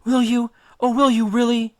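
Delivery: high, pleading goddess voice; a lovesick nymph clinging to him, pleading high and fast; Calypso pleading. Acted. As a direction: beg him to stay, voice lifted high and urgent.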